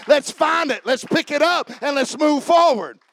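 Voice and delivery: loud, high rallying cry; rally them to action; preaching at full force. Acted. Preacher urging the church on, shouting.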